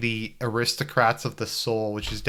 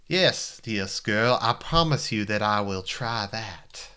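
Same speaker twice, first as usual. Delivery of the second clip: put-on slow old Virginia drawl; courtly Southern gentleman, Virginia accent, drawn out; slower than usual. Promising a girl he will try, Virginia accent. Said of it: "Maybe I'll do a Virginia accent".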